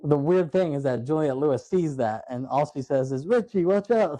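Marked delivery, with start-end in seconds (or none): 3.3-4.2 high-pitched imitated warning cry; mock alarmed warning. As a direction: call out a warning, hammed-up. Retold warning at vampire transformation.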